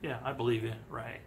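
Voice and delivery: low, dry, unconvinced; sarcastic disbelief. Retold or imitated. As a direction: pretend to believe him. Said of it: "just like the guy going".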